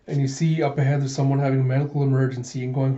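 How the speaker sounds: plain reading voice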